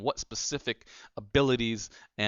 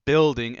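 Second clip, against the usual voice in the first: building punched loud and high, then falling; stress on building; 3 semitones higher, 10 dB louder than usual. Shown as a demonstration. Telling listeners which word to use, stressing building. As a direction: hit the word building hard and let it drop. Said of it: "and I emphasize the word".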